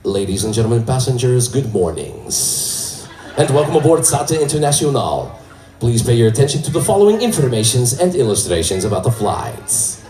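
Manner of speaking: put-on low, smooth Portuguese-accented English; a lightly sultry pilot with Portuguese accent; sexy telenovela English. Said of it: "in that really sexy telenovela English".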